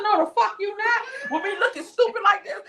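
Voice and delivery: high, loud outraged no; indignant loud refusal. Retold or imitated. Refusing the salon's Instagram post, high and indignant. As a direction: shut the idea down, half laughing.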